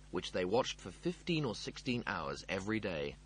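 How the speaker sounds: ordinary delivery, nothing marked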